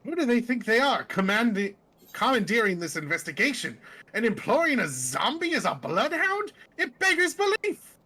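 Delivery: pompous outrage climbing high; indignant seer. Acted. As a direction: rant incredulously, ending on a high cry.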